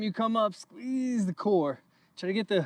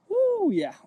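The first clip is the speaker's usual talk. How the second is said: excited whoop; 13 semitones higher than usual. Real, not acted. Coach cheering during workout.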